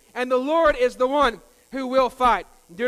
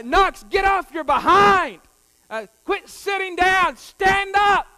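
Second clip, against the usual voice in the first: loud, higher yelled commands; coach's shout again; 7 semitones higher, 7 dB louder, slower than usual. Retold or imitated. Coach yelling at Knox to stand up, loud shout. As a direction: yell it from the sideline. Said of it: "But he said, uh".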